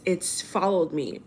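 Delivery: ordinary delivery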